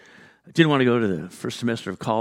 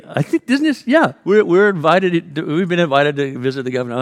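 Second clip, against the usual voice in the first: 1 unsure insistence; 5 semitones higher, 7 dB louder than usual. Retold or imitated. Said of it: "looking at each other saying".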